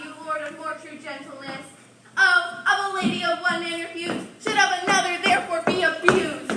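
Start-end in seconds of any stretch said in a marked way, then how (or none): 2.2-6.5 indignant outburst. Acted.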